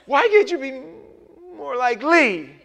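high, loud whiny wife voice with a laugh; hammed-up nagging wife comparing him to Lee; mock nagging wife. Pastor imitates a wife nagging her husband, loud and whiny.

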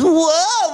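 drawn-out high-pitched whoa; hyped, high exclamation; amazed high "whoa". Retold or imitated. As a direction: whoop it in awe.

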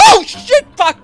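high loud startled yelp; scared shriek. Real, not acted. Startled in horror game.

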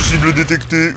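flat machine announcement; toy robot voice. Pretend. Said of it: "je suis un robot".

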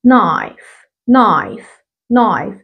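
"knife" said as naif with no k sound; silent k demo. Shown as a demonstration. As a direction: drop the k in "knife", start straight on n. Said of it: "بقولها knife". ("knife" من غير ما تنطق ال K)

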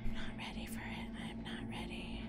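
scared unvoiced whisper. Real, not acted. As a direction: whisper it, dreading the chase. Hiding, dreading going out.

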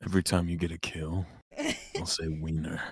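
low, soft, quick deadpan; deadpan parody. Retold or imitated. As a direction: undercut the silly line with a low, quiet delivery. Deadpan parody of the high impression.

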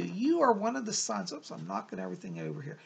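normal talking voice

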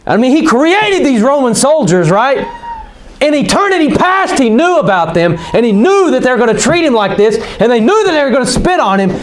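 high-pitched, urgent preacher delivery; impassioned preaching. Real, not acted. Preaches at full force.

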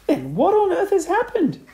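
high, loud cry of alarm; high, loud outcry. A narrator's reading. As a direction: exclaim it high-pitched, as a panicked wife. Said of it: "cried Missus Twit".

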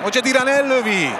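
excited hungarian sports commentary. Acted. Live commentary, shot on goal.

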